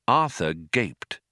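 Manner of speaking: ordinary delivery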